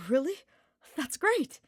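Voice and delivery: high, loud delight; beaming. Acted. Ignatz beaming with delight at Hubert's approval, high and loud. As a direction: burst out happily. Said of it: "Ignatz beamed".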